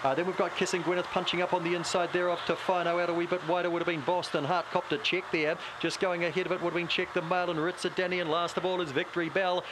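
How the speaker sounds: normal talking voice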